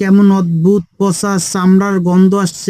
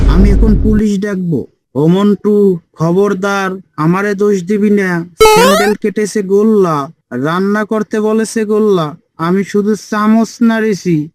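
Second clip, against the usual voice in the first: ordinary talk, nothing marked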